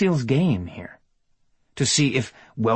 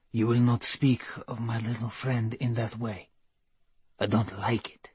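quiet, cool, commanding; calm authority, no raised voice; 6 dB quieter than usual. A narrator's reading. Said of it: "with cool command in his voice".

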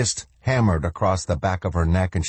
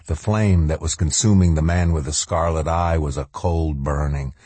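ordinary delivery, nothing marked

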